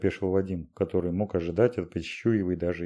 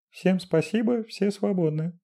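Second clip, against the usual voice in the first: Dashenka voice; 12 semitones higher than usual. A narrator's reading. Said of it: "бросила на прощание".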